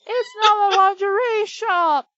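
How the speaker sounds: high, loud, incredulous insistence; exasperated shout. Real, not acted. Reader repeating the objection to the co-host, exasperated shout. Repeats protest over laughter.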